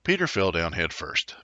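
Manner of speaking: ordinary delivery, nothing marked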